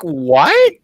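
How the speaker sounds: high, loud and giggly; co-host laughs while imitating Bruce's baffled reaction; laughing mock what. Cohost mimics Bruce, laughing.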